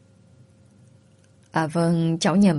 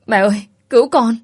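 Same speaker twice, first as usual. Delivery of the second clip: higher, louder frightened cry; crying for help; 5 semitones higher, 5 dB louder than usual. Acted. Terrified girl crying out to her mother for help. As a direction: call out to mom in panic. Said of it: "nghe tôi nói kêu cứu".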